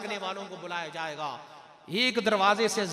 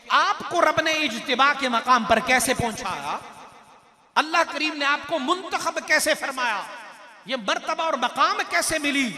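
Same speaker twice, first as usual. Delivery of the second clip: loud, raised rhetorical questions; impassioned preaching; 4 semitones higher, 7 dB louder than usual. Acted. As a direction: ring out each question to the congregation. (जोशीली तक़रीर, बुलंद आवाज़ में सवाल)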